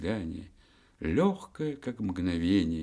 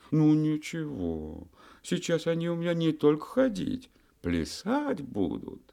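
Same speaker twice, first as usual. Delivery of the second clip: high, playful boast; lightly voiced playful May; 10 semitones higher than usual. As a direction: tease it like a merry youth.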